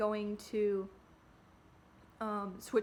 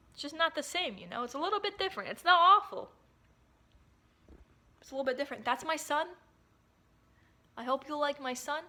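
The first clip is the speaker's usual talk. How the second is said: a light, higher silly put-on voice; 5 semitones higher, 4 dB louder than usual. Talking to the camera about her face, in a lightly raised silly voice. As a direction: goof around in a lightly raised quirky voice. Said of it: "I don't know why I'm doing this voice".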